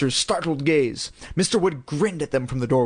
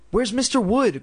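higher, pushy question; impatient demand; 8 semitones higher than usual. A narrator's reading. Chris demanding her sister hand back the dummy. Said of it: "Chris demanded impatiently".

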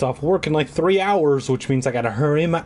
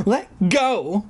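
put-on higher-pitched, insistent child; 6 semitones higher than usual. Little Alicia to the dummy gripping her hand, insistent. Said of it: "she insisted".

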